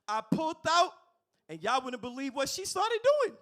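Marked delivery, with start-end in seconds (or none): 0.0-0.9 rises to a high loud shout; building yell. Acted. Comic building to the punchline, rising to a loud shout. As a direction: climb higher and louder to the punchline.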